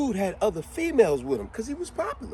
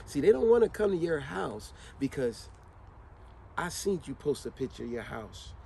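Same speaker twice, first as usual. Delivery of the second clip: usual speaking voice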